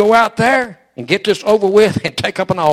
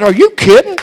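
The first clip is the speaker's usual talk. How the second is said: astonished disbelief; 11 semitones higher, 6 dB louder than usual. Retold or imitated. Astonished at woman's healing.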